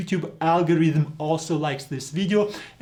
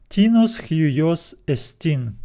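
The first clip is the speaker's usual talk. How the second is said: slow, careful reading of Greek; 2 semitones higher, slower than usual. Shown as a demonstration. Teacher reading a Bible verse slowly to students. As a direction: read each syllable slowly for students. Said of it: "Let's read".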